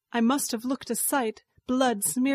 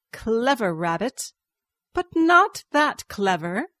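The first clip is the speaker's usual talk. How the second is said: snarl; 6 dB louder than usual. A narrator's reading. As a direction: snarl the taunt, building in pitch and volume. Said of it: "She snarled".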